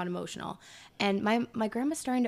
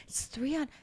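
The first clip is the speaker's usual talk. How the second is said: put-on Scottish accent; 6 semitones higher, 5 dB quieter than usual. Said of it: "in her Scottish accent".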